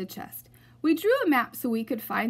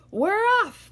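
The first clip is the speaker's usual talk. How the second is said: much higher, louder crew cheer; shouted; 11 semitones higher, 8 dB louder than usual. Acted. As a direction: roar it out together. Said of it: "we all shouted".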